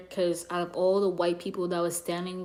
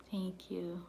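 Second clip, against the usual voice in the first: whispered thanks; 10 dB quieter than usual. Real, not acted. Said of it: "thanking chat".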